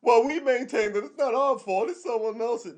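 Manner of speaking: high, prissy company statement; high, smug mock spokesman; mock corporate spokesman. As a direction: parody a company dodging blame. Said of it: "Hertz does what all these".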